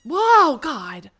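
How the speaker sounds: loud, high startled yelp; startled shout. Real, not acted. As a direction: cry out in sudden shock. Startled by the game.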